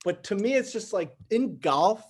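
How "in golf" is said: golf with a flat A, "galf"; New York pronunciation. Real, not acted. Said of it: "hate the way I just said that".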